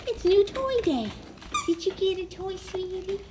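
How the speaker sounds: high-pitched cooing at the dog; excited owner cooing up high at her dog; high pet talk. Real, not acted.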